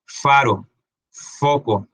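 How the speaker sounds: "faro, foco" with the opening f blown; words led by an airy, emphasised f. Shown as a demonstration.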